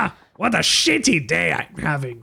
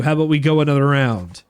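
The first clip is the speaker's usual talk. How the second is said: ordinary talk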